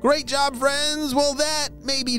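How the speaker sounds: high, bright praise for little listeners; kindergarten teacher voice. Acted. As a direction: cheer the kids on sweetly. Cheery quiz to children.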